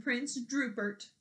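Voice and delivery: ordinary delivery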